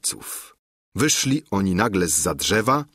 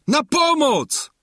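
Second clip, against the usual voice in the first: high, drawn-out cry for help; long panicky call, pitched very high; shout for help; 4 dB louder, slower than usual. A narrator's reading. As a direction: yell for rescue in fright. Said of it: "zawołał".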